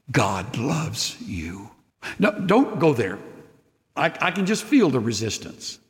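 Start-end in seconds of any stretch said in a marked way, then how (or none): 0.1-1.7 tender assurance. Real, not acted.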